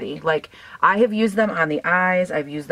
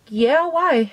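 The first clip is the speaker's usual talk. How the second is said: own reply; 9 semitones higher than usual. Retold or imitated. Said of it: "And I was like".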